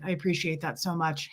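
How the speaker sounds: ordinary talk, nothing marked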